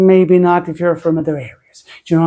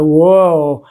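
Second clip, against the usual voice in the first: louder, drawn-out "whoa"; demonstrated "whoa"; 6 dB louder than usual. Shown as a demonstration. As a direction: swoop through a careful-turning "whoa". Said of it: "You gotta go".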